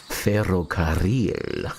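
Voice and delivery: slow, drawn-out "Ferrocarril" with long trills; exaggerated rolled Rs. Player playfully repeating the practiced word, long-trilled "Ferrocarril".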